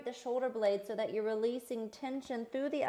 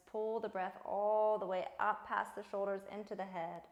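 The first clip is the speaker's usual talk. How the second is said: usual speaking voice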